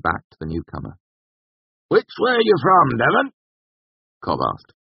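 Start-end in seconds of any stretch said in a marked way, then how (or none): 1.9-3.3 high, loud rustic questioning; nosy tavern regular. A narrator's reading. Cobb asked the newcomer, nosy. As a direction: quiz him across the bar.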